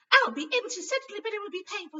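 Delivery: high, loud, stern nurse voice; grim nurse. A narrator's reading. Nurse warning Harry of pain, grimly. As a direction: warn him flatly it will hurt.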